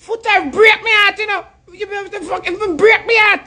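pleading falsetto mock of a wounded lover; mock Aisha. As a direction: squeak it out like a lovesick woman moaning to her man. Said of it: "We tap use a voice, they can say it annoying".

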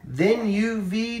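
ordinary delivery

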